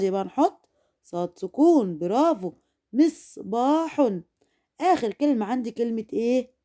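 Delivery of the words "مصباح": "مصباح" slowly, held on the ص; pause on ص. Shown as a demonstration. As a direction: say "مصباح" as "mis", then a long "baa-hun". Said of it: "وقفت على الصاد". (نطق "مصباح" ببطء مع الوقوف على الصاد)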